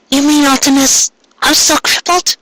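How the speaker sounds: lightly voiced tearful girl; distressed girl. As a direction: ask in dismay.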